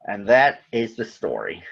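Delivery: ordinary delivery, nothing marked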